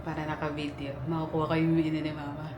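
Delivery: hushed, sneaking whisper; whispering while hiding. Real, not acted. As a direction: keep it low so nobody hears. Sneaking in for surprise birthday.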